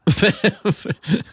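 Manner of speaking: kurzes hohes Auflachen; hohes Lachen. Real, not acted. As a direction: hell und kurz auflachen. Lacht beim Vorlesen der Mail. (hohes Auflachen)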